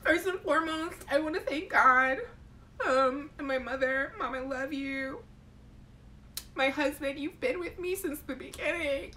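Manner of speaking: mock-tearful award winner, pitched high; high, choked-up thank-you speech; Oscar acceptance speech. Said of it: "act like I won an, an Oscar".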